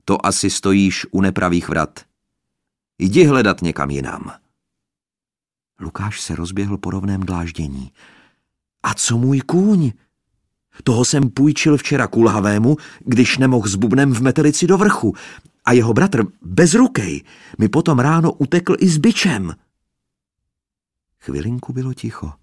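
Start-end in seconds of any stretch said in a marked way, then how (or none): 0.1-4.3 slightly stern. A narrator's reading. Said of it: "Hlas trochu zpřísněl". 8.8-19.6 high, loud call upward. A narrator's reading. Boy calling up to woman.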